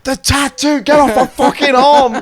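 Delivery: high and loud, hammed-up; mock pained yell. Friend shouts about his fresh tattoo, mock pained yell. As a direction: snap it out in mock pain.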